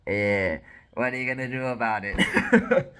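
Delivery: loud, laughing, hammed-up taunt; mock taunt. Smiling betrayer to her husband, mock taunt. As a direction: taunt them loudly, breaking into a laugh.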